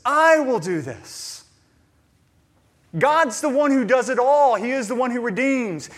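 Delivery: preacher insisting God does it all; fervent preaching. Real, not acted. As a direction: drive the point home to the congregation. Preaching at full force.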